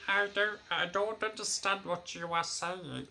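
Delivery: lightly voiced tinny phone assistant; tinny robot voice. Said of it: "this tinny voice came back saying".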